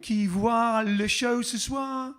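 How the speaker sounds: playful French welcome to viewers; hammed-up French announcer greeting; faux French accent. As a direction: greet the audience in put-on French. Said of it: "Faux French accent".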